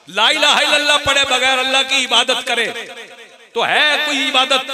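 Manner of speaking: high, loud, sustained sermon cry; ringing preacher's challenge; full-force preaching. Real, not acted. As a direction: throw the question hard at the congregation.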